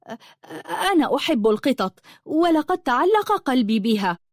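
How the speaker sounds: hesitant stammer turning into an earnest plea. A narrator's reading. Lulua confesses her love for the cat, stammering. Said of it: "قالت لؤلؤة متلعثمة".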